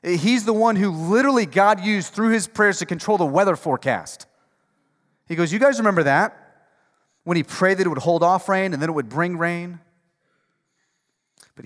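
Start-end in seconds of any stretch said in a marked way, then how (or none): 0.0-9.8 loud, driving sermon delivery; rising revival preacher; full-force preaching. Acted. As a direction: preach it out to the whole room.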